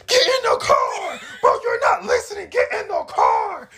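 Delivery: high-pitched mock yelling at her to get in; retold angry shouting. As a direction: shout it high like a furious young boyfriend. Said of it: "my anger was so bad back then".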